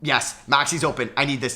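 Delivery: ordinary delivery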